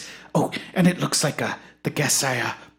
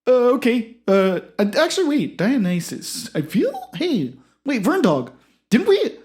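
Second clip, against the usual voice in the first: much higher and louder, welcoming; high eager host voice; 5 semitones higher, 4 dB louder than usual. Acted. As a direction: usher the guest in brightly.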